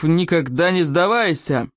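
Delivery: slow, solemn motto; dignified. A narrator's reading. Chief states the tribe's motto, dignified. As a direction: proclaim it slowly with a chief's dignity. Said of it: "с великим достоинством".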